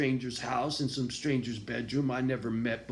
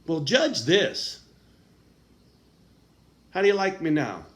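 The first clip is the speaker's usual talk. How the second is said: defiant challenge; 2 semitones higher, 5 dB louder than usual. Real, not acted.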